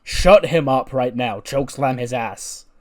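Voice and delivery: slow, loud command; barked order. Acted. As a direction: bark the order at him.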